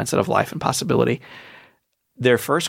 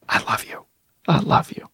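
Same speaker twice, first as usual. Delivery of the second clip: mother's whisper. Retold or imitated. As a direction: breathe it like a mother over her hurt child. Dying son, mother at bedside.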